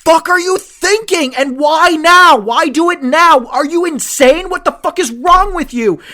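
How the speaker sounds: high, loud exasperated shouting; furious rant. Retold or imitated. Fan raging at his quarterback over the interception. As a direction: scream it at the quarterback in disbelief. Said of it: "all I could think is just like".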